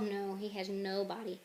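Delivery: usual speaking voice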